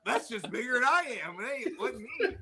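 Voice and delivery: a hint of a high-pitched innocent dog; high mock denial from the dog; lightly voiced dog. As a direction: plead the dog's innocence, lightly hammed-up. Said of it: "Yeah, it's like".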